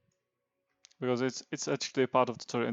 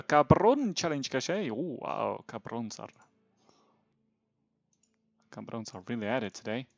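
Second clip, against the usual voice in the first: usual speaking voice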